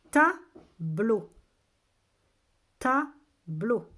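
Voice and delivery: slow, loud, separated syllables. Shown as a demonstration. Teacher has children listen for A, syllable by syllable. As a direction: sound the word out in two beats. Teacher sounding out word for pupils.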